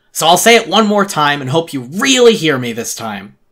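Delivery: loud, high, scolding mentor figure; stern lecturing voice. Acted. Creature scolding the narrator to grow up, loud and stern. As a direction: lay down the law, pushing hard.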